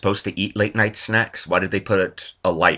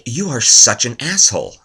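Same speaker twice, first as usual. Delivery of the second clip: angry buddy; 6 semitones higher, 6 dB louder than usual. Retold or imitated. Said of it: "he was like".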